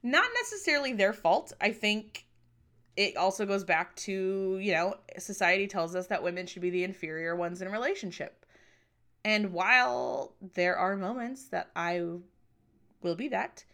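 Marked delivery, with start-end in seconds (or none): none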